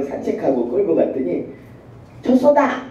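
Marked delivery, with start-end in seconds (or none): 2.2-2.8 high, loud excited cry; a toddler shouting at a dog; excited child. Retold or imitated. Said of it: "이러더라고요".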